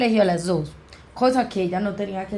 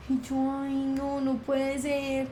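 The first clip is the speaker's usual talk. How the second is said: gasp, then a soft, higher, frightened ay no; softly dreading the worst, breath caught; hushed and scared, voice lifted, slowing at the end; 5 semitones higher, 7 dB quieter, slower than usual. Retold or imitated. Realizes sister will die, gasps.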